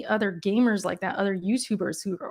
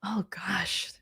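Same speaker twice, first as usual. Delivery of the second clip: whispered aside; 9 dB quieter than usual. Real, not acted. Thinking aloud under her breath.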